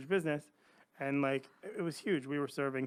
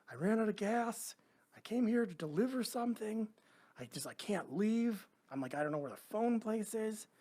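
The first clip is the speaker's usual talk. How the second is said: scared whisper; 8 semitones higher than usual. Retold or imitated. Woken by a gunman at the car.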